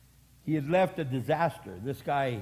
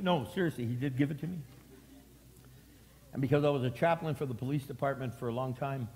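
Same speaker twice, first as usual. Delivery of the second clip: normal talking voice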